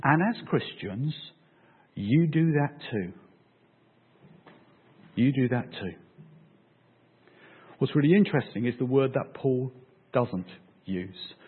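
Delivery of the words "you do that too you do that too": ordinary talk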